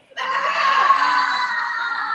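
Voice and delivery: high, loud laughing bleat. Real, not acted. Teacher bleating high and loud as a giraffe, laughing.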